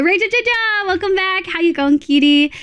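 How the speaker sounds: high, cheery welcome; high sing-song welcome to a chat regular; bright greeting. Real, not acted.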